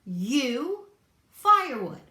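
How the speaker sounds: gruff repeated command; impatient varmint. A narrator's reading. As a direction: bark it at the dim bunny.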